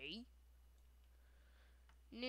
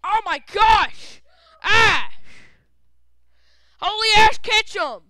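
loud, high burst of excitement; holy-cow gamer yell; excited shout; 5 semitones higher, 8 dB louder than usual. Real, not acted. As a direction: yell it out in shock. Excited outburst while playing.

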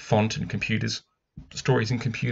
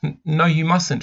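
put-on frightened girl; 7 semitones higher, 4 dB louder than usual. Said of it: "she said".

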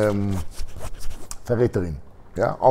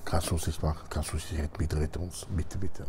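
softer, hushed begging; soft pleading; 6 semitones lower, 12 dB quieter than usual. Acted. Girls begging the capo woman, soft pleading. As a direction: plead quietly and desperately.

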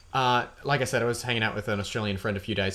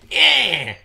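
loud, higher Australian "Yeah!"; caricature Australian accent; 4 semitones higher, 8 dB louder than usual. Shown as a demonstration. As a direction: burst out in a cartoonish Australian accent. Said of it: "that's the satirization of the Australian accent".

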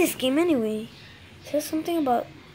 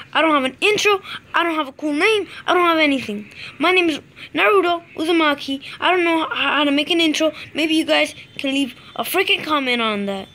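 loud, higher exasperated rant; loud frustrated rant; 4 semitones higher, 6 dB louder than usual. Real, not acted. Gamer rants to viewers about no intro, loud exasperated rant. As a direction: vent at the viewers full volume.

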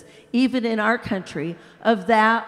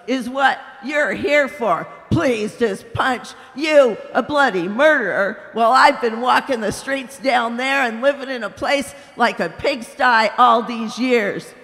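big ghost, indignant; 4 dB louder than usual. Acted. Big ghost shouting at the bright spirit, loud and outraged. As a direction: bellow it like an aggrieved bully.

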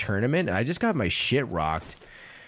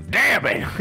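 put-on high, loud yell in a southern accent; 12 semitones higher, 7 dB louder than usual. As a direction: imitate a southern character yelling when shot. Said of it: "In a fucking southern accent".

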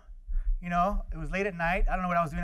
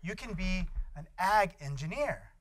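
excited teacher; 2 semitones higher, 7 dB louder, slower than usual. Retold or imitated. Teacher thrilled to announce Tim's career. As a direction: gush over the result. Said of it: "she goes".

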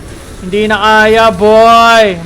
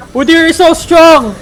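loud, higher, slow marvel; loud slow awestruck cry; excited exclaim; 8 semitones higher, 7 dB louder, slower than usual. Real, not acted. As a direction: exclaim it in awe. Marvels at enemy Udyr.

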